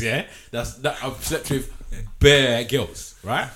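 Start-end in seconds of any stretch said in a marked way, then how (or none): none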